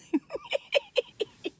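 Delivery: high-pitched giggle burst. Real, not acted. Laugh after rhyme.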